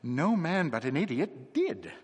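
a slow, deliberate punchline; slow, emphatic. Acted. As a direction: lean on "did", slowly and firmly. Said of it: "said the curate".

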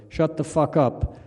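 slow, lightly voiced sleepy grumble; sleepy echo. A narrator's reading. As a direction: drag it out like a half-awake neighbor. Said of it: "Another sleepy voice echoed".

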